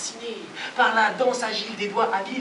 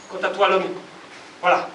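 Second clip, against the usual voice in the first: ordinary delivery, nothing marked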